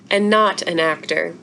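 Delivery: plain reading voice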